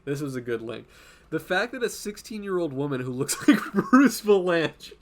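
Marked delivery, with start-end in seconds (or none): 1.3-4.9 laughing. Real, not acted. Laughs while describing funny reply.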